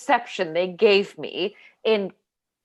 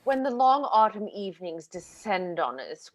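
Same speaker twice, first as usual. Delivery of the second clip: ordinary talk, nothing marked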